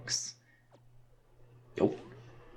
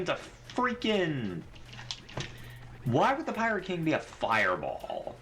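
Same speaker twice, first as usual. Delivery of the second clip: ordinary talk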